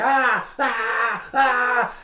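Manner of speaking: high strained ah ah ah; hammed-up fighter in pain; mock pained groans. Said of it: "a guy's like".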